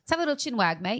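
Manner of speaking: hammed-up British "mate"; mock British accent. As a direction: play at being a Londoner inviting a chat. Mock British phrase use.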